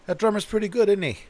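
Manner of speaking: impressed concertgoer. Retold or imitated. Concertgoer beside the tape player, impressed by the drummer. Quoting an impressed audience member.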